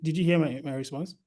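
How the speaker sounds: normal talking voice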